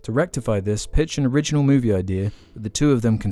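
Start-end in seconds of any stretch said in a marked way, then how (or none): none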